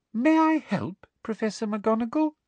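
high, sugary offer of help; poisonously sweet. Acted. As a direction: sweetness laced with venom. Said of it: "in her most poisonously sweet voice".

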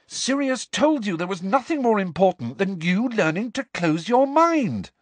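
high, heated overriding voice; exasperated, high-pitched lecture; insistent scolding. A narrator's reading. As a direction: talk over him, slowing on the last words. Acted quarrel, talking over him.

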